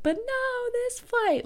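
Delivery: high, whiny, melodramatic mock wail; mock drama queen. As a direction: play your past self as a drama queen. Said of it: "such a drama queen about it".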